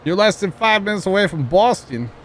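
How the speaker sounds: put-on high-pitched Boston accent caricature; mock Boston accent. Teasing Sean about living near Boston, Boston accent. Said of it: "I'm gonna offend somebody".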